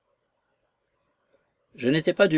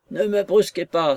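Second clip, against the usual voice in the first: higher, louder plea; crying out; 7 semitones higher, 3 dB louder than usual. Acted. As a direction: cry out in protest. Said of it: "s'écria monsieur Fairley".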